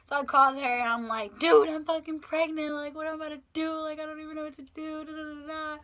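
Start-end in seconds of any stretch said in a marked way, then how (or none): 1.4-5.8 high, distressed confession; retold panic. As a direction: spill it to a friend, pitched up. Panicked phone call to friend retold.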